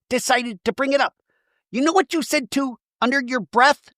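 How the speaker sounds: high, wounded mock tirade; hammed-up high-pitched indignation; indignant mock protest. Imitating Shannon's indignant outburst.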